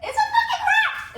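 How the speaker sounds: high shrieking yell through laughter; shocked shriek. Real, not acted. Vlogger spots a rat in bonsai, shrieking. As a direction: scream it, half laughing in disgust.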